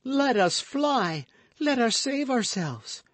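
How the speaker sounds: very high, loud, drawn-out plea; affrighted cry. A narrator's reading. Frightened woman urges the guardsman to flee, affrighted cry. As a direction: cry out in fright, stretching the words. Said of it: "cried the affrighted Madame Bonacieux".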